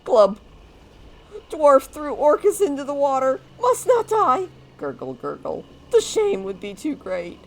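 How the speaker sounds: frantic high gurgling screams; drowning orc yelling. Acted. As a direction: yell for help while going under. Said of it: "Orcus is drowning!".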